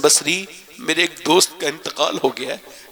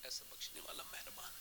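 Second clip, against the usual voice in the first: hushed whisper; 2 semitones lower, 30 dB quieter than usual. Real, not acted. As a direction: whisper it in awe. Hushed close of story. (سرگوشی میں)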